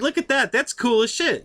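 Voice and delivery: pointing it out, high and eager; nudging dad to look, delighted; excited. Retold or imitated. Telling dad about the plate, excited.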